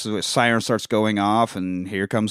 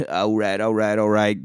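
McConaughey impression; 2 semitones lower than usual. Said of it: "feel-good guy from Dazed and Confused".

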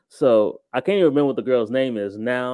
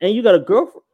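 shocked disbelief; 5 semitones higher, faster than usual. Retold or imitated. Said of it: "my heart literally was just like".

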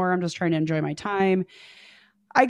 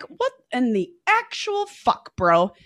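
incredulous outrage; 5 semitones higher, slower than usual. Retold or imitated. Finding date tripped on acid.

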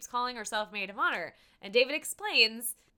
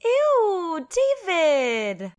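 catchphrase voice; 8 semitones higher, 7 dB louder, much slower than usual. Retold or imitated. Sister's catchphrase quoted.

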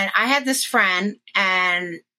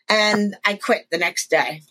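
ordinary talk

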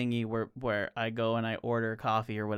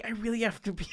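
sleepy wife voice; 9 semitones higher, 4 dB quieter, faster than usual. Retold or imitated.

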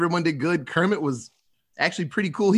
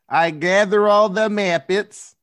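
slow, louder mock Russian accent; 3 semitones higher, 5 dB louder, slower than usual. Announcing a Muppet movie synopsis in a Russian accent. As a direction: put on a drawn-out Russian accent. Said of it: "Russian accent".

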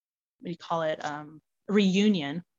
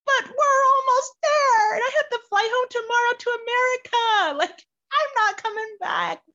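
tearful pleading; 7 dB louder than usual. Retold or imitated. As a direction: beg through tears not to turn back. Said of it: "I am just not doing very well".

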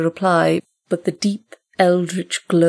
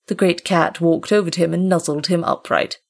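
plain reading voice

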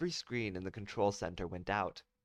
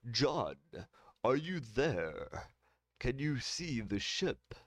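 slow counselor voice; 3 semitones higher, much slower than usual. Acted.

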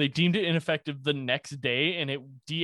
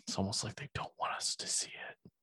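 conspiratorial whisper; 5 semitones lower, 11 dB quieter than usual. Pretend. Conspiracy joke about hidden site.

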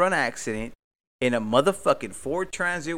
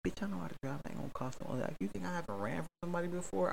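muttered retort; 4 semitones higher, 10 dB quieter, much faster than usual. Retold or imitated. Snapping back at dispatcher.

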